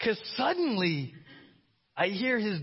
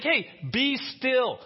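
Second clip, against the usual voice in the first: mock command; 6 semitones higher, 4 dB louder, slower than usual. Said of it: "He's like".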